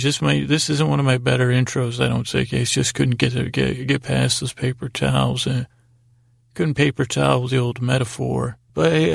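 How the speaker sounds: ordinary talk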